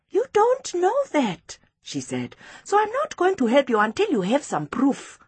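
put-on high-pitched, insistent child; Precious, firm schoolgirl. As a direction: stand firm in a little girl's voice.

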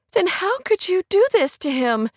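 high, accusing voice; upset friend. A narrator's reading. Kate accuses Rory of hurting Clay, upset. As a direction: reproach her, pitched well up.

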